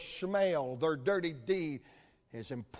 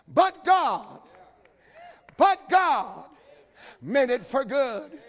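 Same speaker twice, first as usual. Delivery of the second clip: loud, high cry on "But God"; shouted refrain; 8 semitones higher, 8 dB louder, slower than usual. Acted. Climactic refrain of the sermon.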